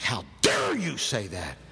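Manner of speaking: slow, low mock outrage bursting on "dare"; indignant scolding. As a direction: chastise them, hitting "dare" hard. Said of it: "chastised me like".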